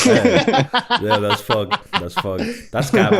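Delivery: high, laughing scoff; laughing disbelief. Real, not acted. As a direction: shrug it off through laughter. Laughing dismissal of implausible claim.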